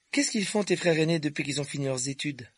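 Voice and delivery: light, high questioning character voice; young Harry's dialogue voice. Acted. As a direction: pitch it up for a boy asking questions.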